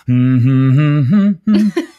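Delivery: loud giddy laugh of a man lost in his dreams; giddy happy laugh. Acted. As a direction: burst out laughing with joy. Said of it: "lost your mind with happiness".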